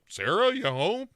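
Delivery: high-pitched, lightly acted call through a house. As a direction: call for someone in a singled-out high voice. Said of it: "calling after her".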